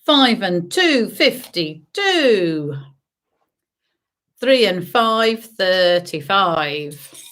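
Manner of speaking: sing-song number calling; bingo caller. Acted. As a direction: call each number out, drawn out. Calls bingo numbers to players.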